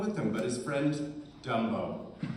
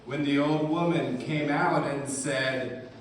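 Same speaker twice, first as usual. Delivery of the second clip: ordinary talk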